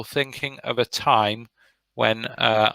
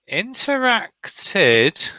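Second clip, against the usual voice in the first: doubtful drawn-out; 9 semitones higher, much slower than usual. Real, not acted. Teacher doubts a student answer.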